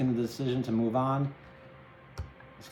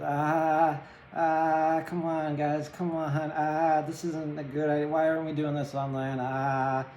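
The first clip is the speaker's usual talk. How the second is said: higher, louder nervous whine; whiny reluctant prince; 4 semitones higher, 4 dB louder, slower than usual. Retold or imitated. Prince of Cups griping to his companions, whiny and nervous. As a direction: complain like a reluctant rider over water. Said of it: "he just looks like this".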